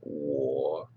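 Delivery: halting learner sounding out "Wo" in third tone. Shown as a demonstration. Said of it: "wo is third tone".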